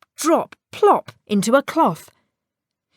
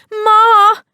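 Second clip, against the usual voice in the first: a high, very loud call for his mother; calling shout; 13 semitones higher, 10 dB louder than usual. Acted. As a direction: shout for Ma from outside. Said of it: "shouted Tom".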